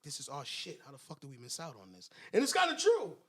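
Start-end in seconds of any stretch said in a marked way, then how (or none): 0.0-2.1 low, hushed mobster imitation; muttered mock grievance; Paulie. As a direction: grumble it softly under your breath. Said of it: "he's like".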